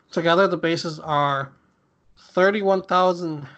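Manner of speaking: normal talking voice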